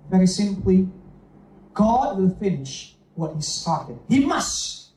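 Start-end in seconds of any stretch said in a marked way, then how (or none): 4.1-4.9 "He must." higher, pressing both words; stressed must. Shown as a demonstration. Preacher stressing the verse to the church, emphatic. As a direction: punch "He must." as a firm claim. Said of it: "there's an emphasis on that word".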